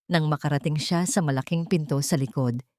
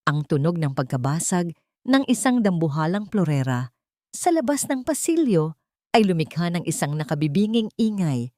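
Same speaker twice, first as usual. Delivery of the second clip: ordinary delivery, nothing marked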